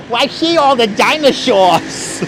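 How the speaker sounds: high, slow imitated kid from a tape; drawn-out excited little kid; kid's voice. Vlogger retelling the Disney tape kid, high and slow. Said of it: "the kid goes".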